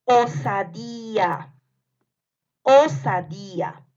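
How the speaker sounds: slow, projected "Osadía"; dictation. Shown as a demonstration. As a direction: draw out every syllable of "Osadía". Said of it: "les voy a ir dictando". (dictado lento y marcado de "Osadía")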